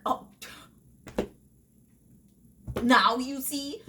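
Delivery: sarcastic reader at the book's ending; mock told-you-so. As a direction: drag out a smug told-you-so. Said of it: "you're like".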